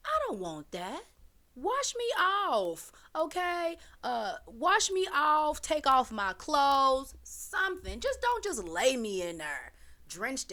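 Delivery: indignant plea to the guards; exasperated demand. Pretend. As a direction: insist like a fussy patient. Complains as if she were him.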